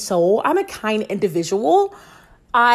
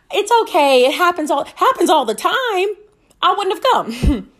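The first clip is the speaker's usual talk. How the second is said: mom voice; 6 semitones higher than usual. Retold or imitated.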